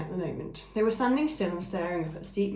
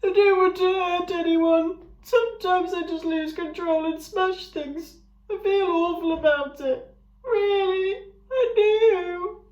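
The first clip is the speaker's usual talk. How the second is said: much higher, loud weepy creature voice; crying ram; 12 semitones higher, 8 dB louder, slower than usual. A narrator's reading. Ram confessing he smashes things, crying. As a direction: cry through it, full of remorse. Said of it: "started to cry".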